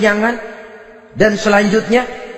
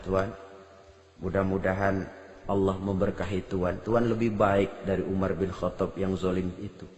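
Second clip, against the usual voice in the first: very hushed storytelling; 12 semitones lower, 13 dB quieter than usual. Acted. Hushed telling of the scene.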